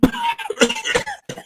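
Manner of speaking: a single rough cough; sick cough. Acted. Line in script: some coughing.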